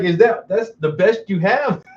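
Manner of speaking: laughing loud and high through the words. Retold or imitated. Laughing back at the neighbor who insulted him. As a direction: laugh it off, amused and unbothered. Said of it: "I started laughing".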